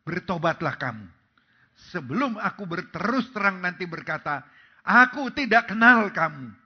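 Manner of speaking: loud warning building to a shout; preacher booming God's warning; forceful call to repent. Acted. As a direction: thunder the final words at the crowd. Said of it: "hari ini Tuhan berkata".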